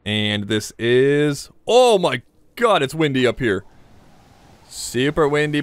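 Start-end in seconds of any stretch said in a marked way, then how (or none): 1.7-2.8 high, drawn-out "oh my God"; startled exclamation. Real, not acted. Climber hit by wind on poking head out, startled exclamation. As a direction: exclaim it slowly, pitched well up.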